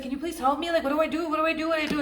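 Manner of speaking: high-pitched, whiny plea for help, put on; hammed-up overwhelmed begging; mock desperate pleading. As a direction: imitate someone frantic and helpless. Imitates person begging for help.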